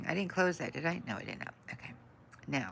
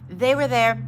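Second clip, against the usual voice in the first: relieved exclamation; 6 semitones higher, 12 dB louder than usual. Real, not acted. Relieved viewers found stream.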